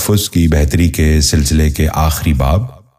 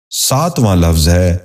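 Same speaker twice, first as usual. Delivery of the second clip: ordinary delivery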